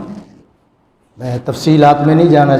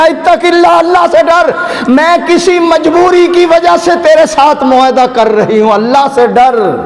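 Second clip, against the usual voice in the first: much higher and louder, a woman begging; pleading woman's voice; 12 semitones higher, 3 dB louder than usual. Acted. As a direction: cry out as the frightened woman. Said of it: "اس نے کہا". (عورت کی اونچی التجا بھری آواز)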